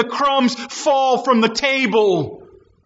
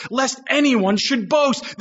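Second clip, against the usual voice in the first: ordinary delivery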